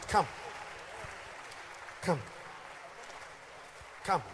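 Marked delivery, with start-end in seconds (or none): none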